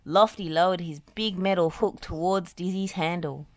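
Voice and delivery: plain reading voice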